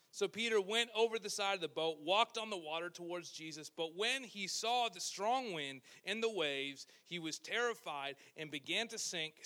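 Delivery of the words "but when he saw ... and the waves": ordinary delivery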